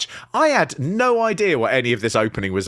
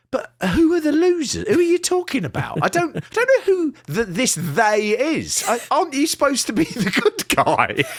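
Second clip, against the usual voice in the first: confused; 10 semitones higher than usual. Retold or imitated. Confused reaction voiced.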